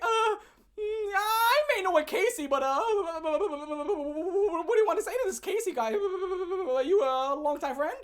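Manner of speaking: high, slow nervous stammer; scared Casey. Retold or imitated. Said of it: "He's like".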